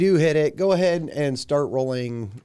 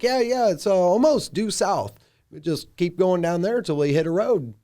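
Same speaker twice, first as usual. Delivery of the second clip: guide Lander; 6 semitones higher, 4 dB louder than usual. Acted.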